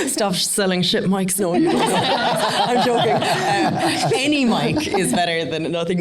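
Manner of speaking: laughing through a mock telling-off; giggling as she teases the shop owner. Real, not acted. Teasing the photographer about his mics, laughing.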